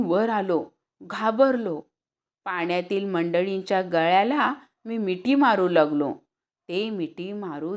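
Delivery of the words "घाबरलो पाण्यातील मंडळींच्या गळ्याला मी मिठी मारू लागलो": ordinary delivery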